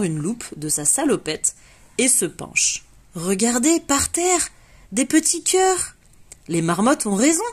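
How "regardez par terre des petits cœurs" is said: excited discovery. Acted. Paloma showing friends the heart-shaped prints, high and eager. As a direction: pitch up for the find on the ground.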